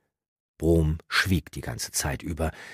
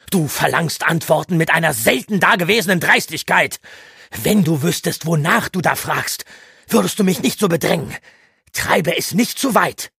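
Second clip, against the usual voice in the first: put-on loud, much higher furious old man; stern rebuke; 13 semitones higher, 9 dB louder than usual. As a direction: put the boy in his place. Brom weist Eragon zurecht. (strenger Zorn, laut)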